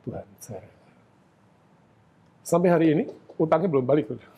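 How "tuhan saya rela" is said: quiet prayer. Retold or imitated. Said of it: "Sama nangis".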